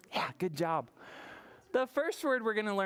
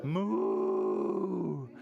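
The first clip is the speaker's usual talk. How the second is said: long, low moo; low like a cow for children; 6 semitones lower than usual. Shown as a demonstration. Teacher answering what the cow says, low moo. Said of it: "What does the cow say?".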